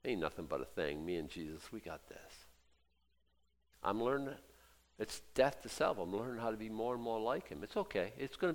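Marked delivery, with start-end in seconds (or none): 0.1-2.4 low, soft, breezy no-big-deal tone; unbothered shrug. Retold or imitated. Believer brushing off suffering, casually unbothered. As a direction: shrug it off like nothing can touch you. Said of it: "and they're like".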